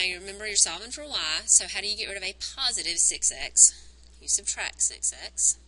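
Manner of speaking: usual speaking voice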